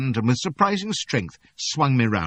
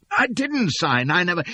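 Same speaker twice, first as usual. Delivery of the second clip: desperate protest; 11 semitones higher, 4 dB louder than usual. Acted. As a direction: protest before he cuts you off. Narrator protesting, cut off.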